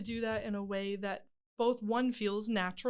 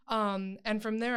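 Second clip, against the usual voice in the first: ordinary talk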